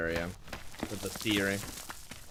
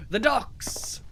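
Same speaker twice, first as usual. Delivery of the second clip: excited shout; 11 semitones higher than usual. Real, not acted. Higher, louder second exclamation.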